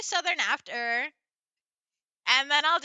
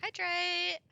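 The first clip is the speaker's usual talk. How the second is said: put-on valley girl accent; 8 semitones higher than usual. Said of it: "be redeemed Valley Girl accent".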